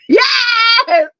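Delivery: high, loud yelp of joy; a thrilled cry of yes; excited cry. Real, not acted. Mom whooping over her daughter's sale.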